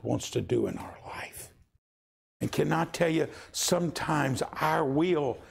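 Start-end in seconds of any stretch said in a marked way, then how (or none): none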